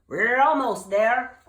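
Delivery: slow, loud reassurance; urgent call. Acted. Gertie calling over the storm, urgent. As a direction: shout encouragement.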